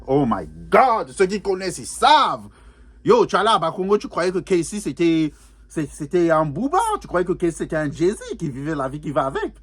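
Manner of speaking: excited reminiscing. Real, not acted. Fan recalling Casey's glory days at Bakongo, excited. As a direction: relive the hype, stretching key words. Hypes up memory of Casey's fame.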